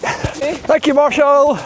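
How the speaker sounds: high, loud call across the path; calling out thanks. Real, not acted. Thanking the marshal while running past, loud high call. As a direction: shout your thanks over to him.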